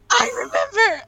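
high, loud burst after a gasp; gasp, then blurt it out excitedly; thrilled eureka cry; sudden recollection. Real, not acted. Sudden recall after searching memory.

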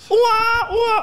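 put-on high wailing baby cry. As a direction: wail like a newborn.

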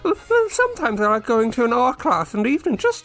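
hammed-up high imitation; mocking Annie. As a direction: carry on the high-pitched mock co-host voice. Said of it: "Oh, I'm Annie".

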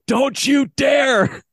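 high, drawn-out outraged shout; furious coach's yell, re-enacted; yelling at umpire. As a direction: holler a warning at the umpire, stretching every word. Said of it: "I was still yelling at this umpire".

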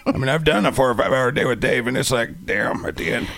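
gargling-chainsaws rasp; throat-hurting character voice. Shown as a demonstration. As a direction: talk as if you swallowed a running lawnmower. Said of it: "it hurts my throat".

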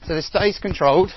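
projecting over the run; loud coaching callout. Real, not acted. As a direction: call it out loud to the group. Coach calling out while running.

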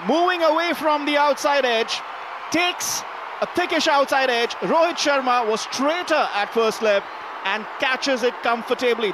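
excited live wicket call; rising commentary as the edge is taken; cricket commentator. Real, not acted.

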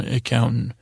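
usual speaking voice

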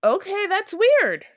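slow, high, loud puzzled remark; drawn-out baffled comment; weirded out. Retold or imitated. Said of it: "And I'm just like".

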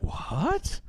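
high, soft rising what; high puzzled what. Retold or imitated. Family hearing a strange noise, high and startled. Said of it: "They're like".

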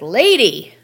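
loud, high-pitched comic outburst; hammed-up exasperated shout; exasperated mock yell. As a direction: yell it at a nagging therapist, half-joking. Acts out snapping at pushy therapist.

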